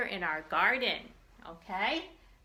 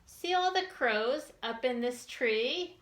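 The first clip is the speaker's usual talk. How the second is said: put-on reading to children; 3 semitones higher than usual. Teacher reads picture book to children.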